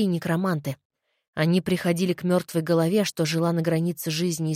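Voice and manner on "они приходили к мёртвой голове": usual speaking voice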